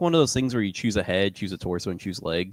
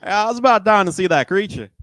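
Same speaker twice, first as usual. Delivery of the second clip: loud, higher hillbilly twang; hillbilly accent; 11 semitones higher, 8 dB louder than usual. Acted. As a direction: holler it like a hillbilly. Copies the hillbilly voice.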